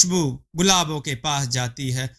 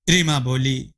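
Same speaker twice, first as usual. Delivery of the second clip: ordinary delivery, nothing marked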